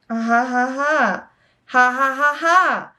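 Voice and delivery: deliberate, evenly spaced ha-ha; mock laugh, each ha separate; spelt-out fake laugh. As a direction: read the laugh out syllable by syllable. Reads out a written laugh.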